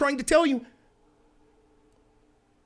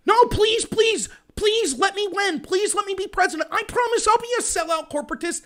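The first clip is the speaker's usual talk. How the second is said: mock begging Bernie; 7 semitones higher than usual.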